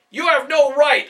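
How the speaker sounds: loud, high, outraged yell; father's yell. A narrator's reading. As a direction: hurl it at the soldiers in fury. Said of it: "a father yelled".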